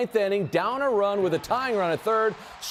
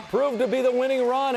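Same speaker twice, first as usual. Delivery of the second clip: excited call; 8 semitones higher than usual. Real, not acted. Anchor calling the fan's dream walk-off, excited and higher. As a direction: ride the thrill of the walk-off. Walk-off win in highlight reel.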